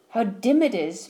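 ordinary delivery, nothing marked

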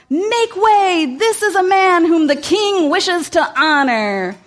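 high, loud herald's call; crying out. Acted. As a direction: announce it to the street like a herald. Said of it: "crying out".

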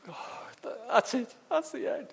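gasped start, then high-pitched dismay; despairing disbelief. Acted. Father hearing his daughter is dead, despairing. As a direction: let the breath go, then cry out in disbelief. Imagines Jairus losing hope.

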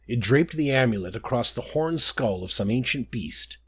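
ordinary delivery, nothing marked

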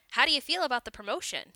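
fast, loud and eager; quick excited question. Acted. Coworker asks eagerly.

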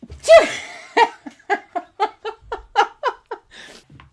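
high, long helpless giggling; can't stop giggling; giggle fit. Real, not acted. Giggling fit after the sneeze.

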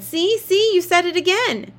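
triumphant yell. Acted. Jamie catches her out.

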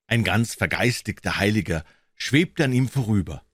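ordinary delivery, nothing marked

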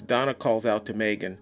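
usual speaking voice

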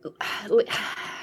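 long fed-up sigh; exasperated sigh. Retold or imitated. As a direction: sigh like you're sick of it. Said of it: "I'm just like".

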